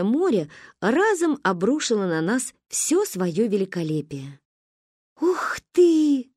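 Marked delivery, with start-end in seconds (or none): 5.2-6.3 high gasping wow; breathless wow. Acted. Girls gasp at the sea, high and breathless. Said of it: "задохнулись мы".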